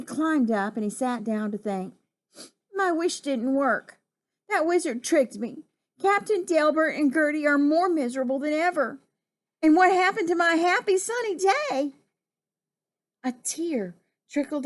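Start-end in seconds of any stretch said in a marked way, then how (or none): 2.7-11.9 higher voice, climbing toward the final question; mildly upset, pitched up in complaint; plaintive lament. Acted. Jubal alone on a toadstool lamenting his wish, plaintive.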